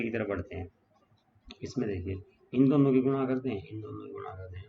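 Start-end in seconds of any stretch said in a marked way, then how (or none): 2.5-3.6 loud, pitched-up classroom call; firm teacher's call for attention; emphatic announcement. Shown as a demonstration. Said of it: "teacher states the step loudly". 3.6-4.7 quiet echo of the step; soft aside to the class; soft repeat. Shown as a demonstration. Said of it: "teacher repeats the step softly".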